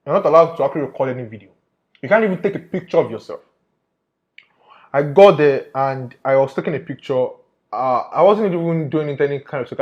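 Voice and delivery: ordinary talk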